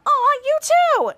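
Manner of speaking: very high, drawn-out cheery coo; happy smile. A narrator's reading. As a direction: gush it sweetly through a smile. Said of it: "said in a happy smile".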